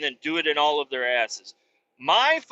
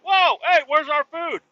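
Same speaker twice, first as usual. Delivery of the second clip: high, loud startled yelp; startled cry; 11 semitones higher, 5 dB louder than usual. Retold or imitated. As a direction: caught off guard, crying out for the missing food. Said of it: "the people that are Palestinian are like".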